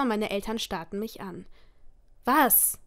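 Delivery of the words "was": annoyed. A narrator's reading. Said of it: "fragte ich genervt".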